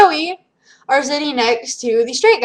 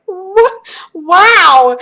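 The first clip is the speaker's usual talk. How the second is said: high, laughing outburst; laughing disbelief; 7 semitones higher, 6 dB louder than usual. Retold or imitated. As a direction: crack up in mock disbelief. Reacting with laughter at screen.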